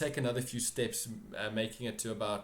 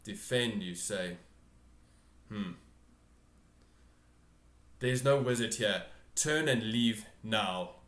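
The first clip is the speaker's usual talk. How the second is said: commanding ruler; 3 dB louder than usual. Acted. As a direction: dismiss them with firm authority. GM voices the throne figure.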